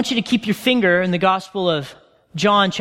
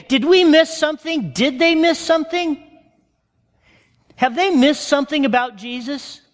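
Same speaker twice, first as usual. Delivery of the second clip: anxious disciples; 5 semitones higher than usual. Retold or imitated. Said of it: "I can almost hear them say".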